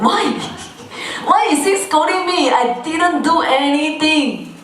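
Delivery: high, wailing bewilderment; hammed-up wounded complaint; acted indignant protest. Acts wife's indignant bewildered thought.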